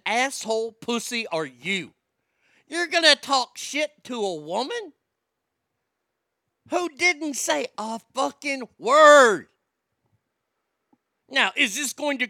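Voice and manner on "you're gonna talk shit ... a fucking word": outraged. Real, not acted. Outraged rant.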